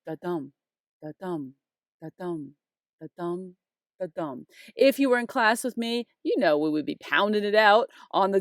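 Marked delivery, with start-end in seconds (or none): 0.0-4.5 five measured "da-dum" beats, heavier on "dum"; "da-dum" soft then heavy, evenly repeated; iambic backbeat. Shown as a demonstration. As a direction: tap out "da-dum" with a pause after each. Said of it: "So the backbeat goes".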